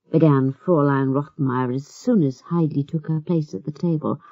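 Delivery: ordinary delivery, nothing marked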